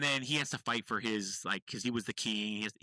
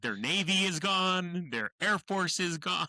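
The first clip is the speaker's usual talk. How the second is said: boastful quote; 7 semitones higher, 4 dB louder, slower than usual. Retold or imitated. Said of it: "He's like".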